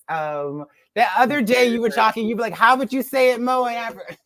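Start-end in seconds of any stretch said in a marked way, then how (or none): 2.5-4.2 imitating her. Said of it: "That was so funny".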